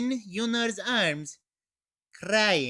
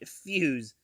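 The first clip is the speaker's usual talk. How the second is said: ordinary talk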